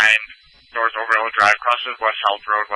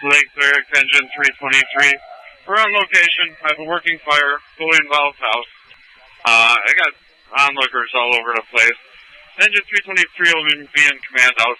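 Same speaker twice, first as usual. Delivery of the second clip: urgent firefighter radio; 3 semitones higher than usual. Real, not acted. First engine arriving at house fire.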